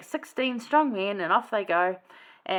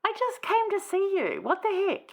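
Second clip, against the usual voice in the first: high-pitched mock indignation; high, contentious retort in a retold scene; 12 semitones higher than usual. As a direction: act out an affronted visitor, pitched well up. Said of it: "contentious back ways".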